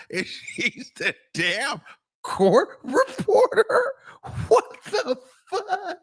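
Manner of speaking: high, slow, squeaking through real laughter; can barely get it out for laughing; laughing so hard the voice cracks up high; laughing disbelief. Real, not acted. Laughing at the dozing reporter.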